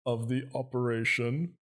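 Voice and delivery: slow, put-on old man voice. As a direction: play a pompous old man slowly. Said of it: "I get his old man voice as best that I can".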